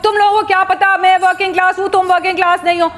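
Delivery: high, fast, jeering bully rant; a bully berating girls who rode bikes, high and fast; mocking bully. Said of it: "अगर वो ये भी".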